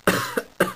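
very high sick cough. Acted. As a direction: cough feebly.

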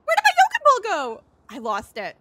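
normal talking voice

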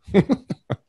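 quick high chuckle; short high laugh. Real, not acted. Laughs after joke.

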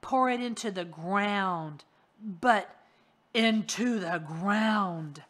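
loud, slow, hollow order; menacing monster command. Acted. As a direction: drag out the command like a buried thing.

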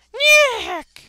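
very high, loud squawk; screaming boss cry. Retold or imitated. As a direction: shriek it like a game boss. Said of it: "if he goes Nick".

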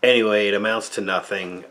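normal talking voice

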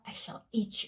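breathy menacing whisper, no voice; whispered threat. Acted. Baba Yaga whispers her threat to Vasilisa, menacing. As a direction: whisper the threat like a hungry witch. Witch threatens to eat the girl.

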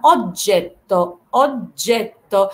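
slow "اوجيتو" with held double j and t; doubled soft g. Shown as a demonstration. Teacher showing the double g rule, slow doubled soft g. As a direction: stretch out "اوجيتو", stopping before each doubled consonant. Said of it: "نفس الطريقه في الجي جي".

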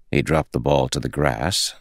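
plain reading voice

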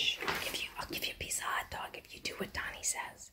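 hushed, voiceless bribe; conspiratorial whisper. Real, not acted. Owner secretly promising the dog hot dog, conspiratorial whisper.

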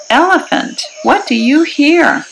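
plain reading voice

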